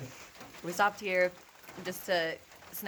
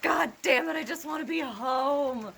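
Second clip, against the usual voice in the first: frustrated outburst; 9 semitones higher, 5 dB louder than usual. Retold or imitated. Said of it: "These are the days that you're like".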